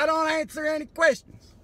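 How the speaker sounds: loud mock yell out the window. Man yelling at the cop through his window. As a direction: bark it through the car window.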